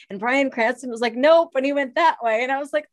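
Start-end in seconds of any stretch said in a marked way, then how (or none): 1.1-1.5 curt dismissive nope. Retold or imitated. Bryan Cranston brushing her off.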